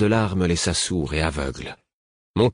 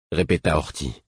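usual speaking voice

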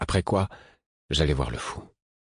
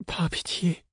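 much higher, weak delirious plea; pleading; 13 semitones higher, 4 dB quieter, slower than usual. A narrator's reading. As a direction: beg for mercy in a high thin voice.

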